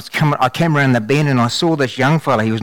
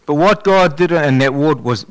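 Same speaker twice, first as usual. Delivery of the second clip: ordinary delivery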